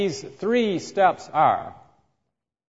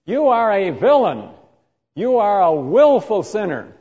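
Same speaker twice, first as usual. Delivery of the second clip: stern accusation; 4 semitones higher, 5 dB louder than usual. Retold or imitated. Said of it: "when I'm saying".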